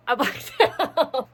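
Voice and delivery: slow, laughing through blacked out. Real, not acted. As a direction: break into a laugh mid-phrase.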